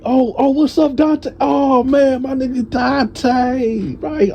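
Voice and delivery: very high-pitched, drawn-out greeting; excited reunion greeting. Retold or imitated. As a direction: greet a long-lost friend, voice way up high. Acts friend's joyful reunion greeting.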